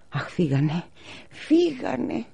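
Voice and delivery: high, despairing mother. A narrator's reading. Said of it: "απελπιζόταν η μαμά". (απελπισμένη μητέρα, ψηλή φωνή)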